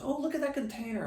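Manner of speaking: high, quick mock-wife voice; imitating his wife. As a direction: chirp it up high like his wife spotting one. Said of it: "My wife always goes".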